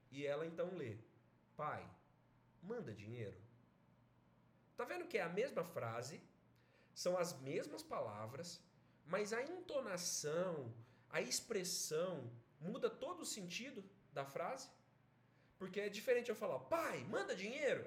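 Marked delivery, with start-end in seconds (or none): 1.6-3.4 low, soft plea; gentle request. Acted. Mother reading her son's telegram aloud, gently pleading. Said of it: "é um pedido". 16.8-17.8 higher-pitched, forceful demand; imposition. Shown as a demonstration. Teacher showing how intonation makes a demand, imperious. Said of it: "é uma imposição".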